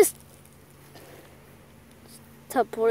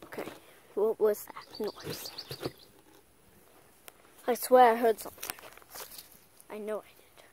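hushed; 3 semitones lower, 9 dB quieter than usual. Acted. Hearing a noise while hunting.

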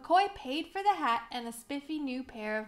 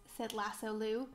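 ordinary delivery, nothing marked

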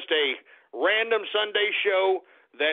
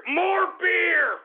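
shouted demand; 9 semitones higher than usual. Real, not acted. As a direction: bellow it across the room. Calling out demanding beer.

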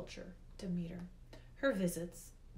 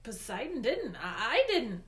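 higher, stammering protest; flustered denial; 4 semitones higher, 8 dB louder than usual. A narrator's reading. Boy denies Hades' charge against Poseidon, flustered denial. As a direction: protest hastily, caught off guard.

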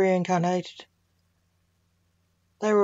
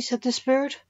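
usual speaking voice

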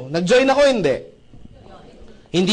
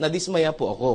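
ordinary delivery

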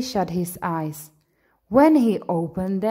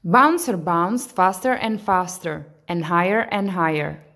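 ordinary delivery, nothing marked

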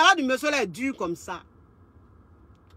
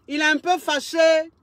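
ordinary delivery

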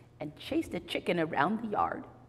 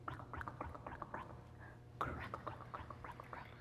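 much softer whispered crackles; 18 dB quieter, faster than usual. Shown as a demonstration. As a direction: rustle it under your breath. Leaves sound effect for children.

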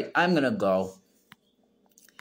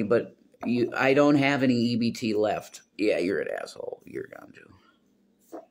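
ordinary talk, nothing marked

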